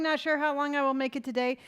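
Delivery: ordinary talk, nothing marked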